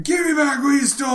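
fast, high put-on shout; mock yell. Clerk yelling at the thief in the street, fast high mock shout. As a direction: bark the demand in a caricature voice. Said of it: "yelling match ensues".

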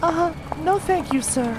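put-on a young girl turning down a stranger, prim and high; wary girl. Girl refusing the stranger's ride, high and prim. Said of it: "so here are the lyrics".

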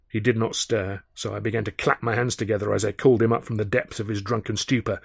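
usual speaking voice

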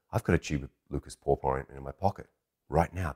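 low, quiet realization to himself; hushed inner thought. Retold or imitated. A man privately spotting his chance with the girl, hushed. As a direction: think it privately, softly and low.

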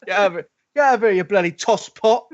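put-on joining in with a cheesy London dub accent. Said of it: "All the guys are, like, um, from London".

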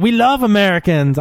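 lightly voiced Scandinavian tourist accent; foreign tourist accent. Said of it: "I don't know how to do their accent".

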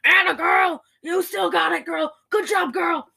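high, shouted mock encouragement; hammed-up "that's my girl" holler; cheering on. Podcaster acting out characters cheering a cranking car, cheering shout.

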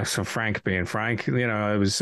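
ordinary talk, nothing marked

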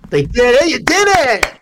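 loud, high-pitched mock celebration; mock cheering. Host teasing co-host over Vikings' win, mock cheering. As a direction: whoop it up like a cheering fan.

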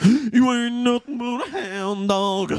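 high, slow [name] singing voice; [name] impression. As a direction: sing it like the King. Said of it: "what do you think of this [name] impression?".

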